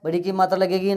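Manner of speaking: ordinary talk, nothing marked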